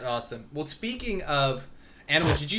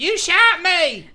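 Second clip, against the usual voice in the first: high, loud, drawn-out accusation; hammed-up outraged wail; mock wailing victim; 12 semitones higher, 9 dB louder, slower than usual. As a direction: holler it slowly like a melodramatic victim. Acts out shot mother-in-law.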